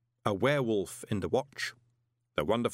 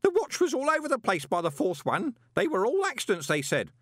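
much higher, louder panicky babble; still babbling; 13 semitones higher, 4 dB louder than usual. A narrator's reading. Groat keeps babbling excuses to Moist, much higher. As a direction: keep gabbling, terrified. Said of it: "Groat babbled".

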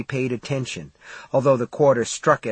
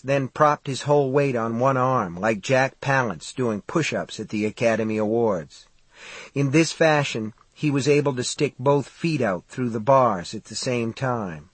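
ordinary delivery, nothing marked

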